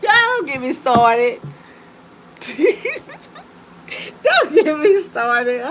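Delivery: slow, loud words through real laughter; helpless laughter, trying to speak; laughing too hard. Real, not acted. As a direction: stretch it out while cracking up. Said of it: "let me calm down".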